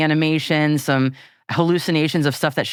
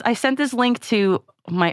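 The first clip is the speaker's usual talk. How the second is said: ordinary talk, nothing marked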